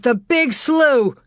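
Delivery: father fearing the slough; terrible exclamation. A narrator's reading. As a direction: realise the danger, slowly. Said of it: "Terribly, he exclaimed".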